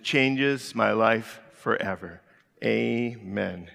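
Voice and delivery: slow, measured congregational recitation; recited declaration. Acted. Pastor leading church in creed, slow measured recitation.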